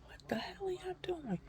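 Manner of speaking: high, hushed squeal of disbelief; retold incredulous protest. Patient asking staff what they are doing, incredulous. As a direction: protest in a small high-pitched voice.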